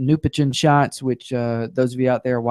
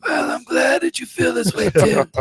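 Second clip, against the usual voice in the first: mock crying; 9 semitones higher than usual. Host thanks the guest with mock tears. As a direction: fake teary voice, pitched up. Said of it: "I'm kidding".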